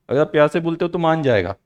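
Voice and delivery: ordinary delivery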